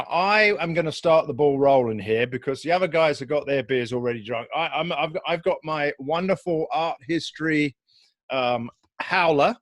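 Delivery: normal talking voice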